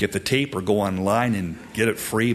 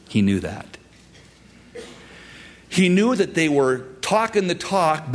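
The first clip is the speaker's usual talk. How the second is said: ordinary talk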